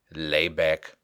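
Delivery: usual speaking voice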